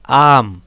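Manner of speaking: आम with a long, weighted आ; loud, lengthened आम, falling in pitch; long vowel demo. Shown as a demonstration. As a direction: press on आम and stretch its first vowel. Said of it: "थोड़ा लंबा भार".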